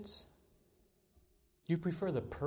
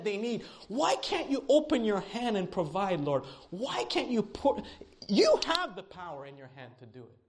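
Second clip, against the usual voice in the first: higher, louder exasperated plea to God; frustrated pleading prayer; 8 semitones higher, 3 dB louder than usual. Retold or imitated. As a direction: demand why God won't provide. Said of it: "you're pleading with God and says".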